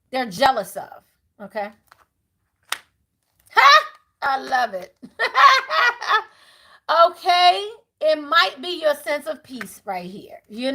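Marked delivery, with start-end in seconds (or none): 3.5-3.8 a sudden high, loud burst of a laugh; a real whoop of delight; delighted shout-laugh. Real, not acted. Bursts out at a reading. 5.0-6.2 laugh. Real, not acted. Laughing after the outburst.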